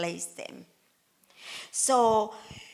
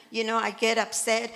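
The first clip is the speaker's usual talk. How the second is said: ordinary talk, nothing marked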